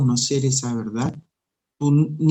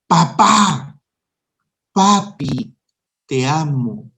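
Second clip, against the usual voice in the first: put-on childlike fond call; 3 dB louder, much slower than usual. Believer running to the Father's feet, calling him in a childlike voice. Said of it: "correr a sus pies y decirle".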